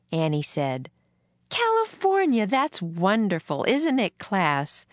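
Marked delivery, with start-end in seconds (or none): 1.5-4.9 delighted exclamation, pitch leaping high then settling; high, thrilled opening word; excited teacher. A narrator's reading. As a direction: marvel at it for the whole class. Teacher delighted at pupil's news.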